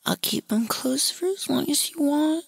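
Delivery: high, rising mock-coy voice; coy client. Client agreeing to keep eyes closed, mock coy. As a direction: play along in a high put-on voice. Client coyly plays along.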